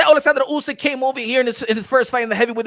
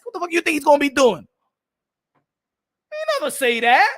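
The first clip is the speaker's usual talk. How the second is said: normal talking voice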